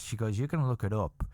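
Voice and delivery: normal talking voice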